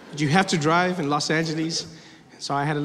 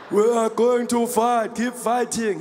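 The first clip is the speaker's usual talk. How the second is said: slow, declaiming politician voice; rally supporters with drawn-out words; ponderous speechmaking impression; Julius Malema; 3 semitones higher, much slower than usual. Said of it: "the voice doesn't match the face".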